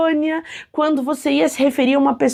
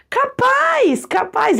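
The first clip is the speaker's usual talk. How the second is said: much higher, scoffing exclamation; incredulous no way; 11 semitones higher, much slower than usual. Real, not acted. Teacher scoffing at being formal with husband, high. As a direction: brush off the idea with a high scoff. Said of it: "dismissing the idea".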